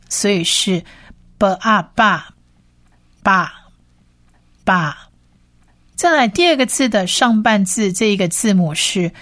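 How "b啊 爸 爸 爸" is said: "b啊，爸" sounded out as b, a, ba; short, separate "爸" after blending b with a. Shown as a demonstration. Teacher joins consonant and short vowel, step-by-step pronunciation demo. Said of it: "它是短母音啊".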